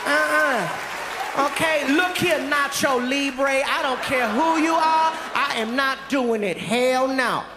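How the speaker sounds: mock indignant woman putting her foot down; sassy refusal. Woman refuses to deliver the basket, sassy.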